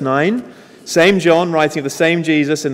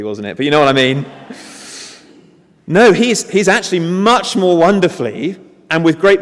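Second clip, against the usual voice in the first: ordinary delivery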